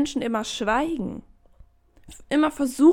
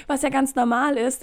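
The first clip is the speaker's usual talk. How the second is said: ordinary delivery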